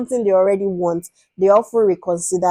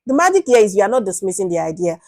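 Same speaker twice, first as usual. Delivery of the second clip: usual speaking voice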